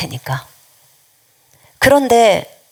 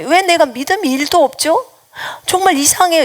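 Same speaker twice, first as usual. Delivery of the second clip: higher-pitched voice of a bewildered believer; 당황한 신자 흉내; 8 semitones higher than usual. Retold or imitated. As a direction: imitate someone puzzled at their own lack of faith. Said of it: "이런 소리를 해요". (의아해하는 높은 목소리로)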